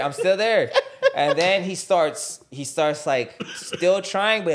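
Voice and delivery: laughing through the words. Real, not acted. Coach laughing while retelling the match. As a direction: chuckle while telling it.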